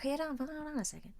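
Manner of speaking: quick whispered interruption. Retold or imitated. Cutting in on a cohost's story, a quick whisper. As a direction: hush it out fast, no voice.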